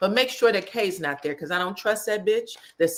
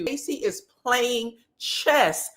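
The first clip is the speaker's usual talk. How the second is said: usual speaking voice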